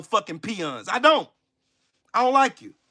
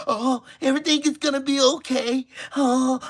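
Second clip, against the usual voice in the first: softer sing-song "everything is gonna be okay"; mock soft reassurance; 2 semitones higher, 5 dB quieter than usual. Mocking the soft-shoeing appeaser, sing-song and soft. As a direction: soothe it like a fake comforter. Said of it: "your soft shoeing and your".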